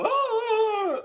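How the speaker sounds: high, sustained wail; imitated scream. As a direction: mimic someone yelling as he's swept up. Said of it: "all you hear is him".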